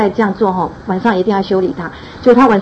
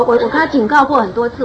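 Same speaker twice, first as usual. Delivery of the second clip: ordinary delivery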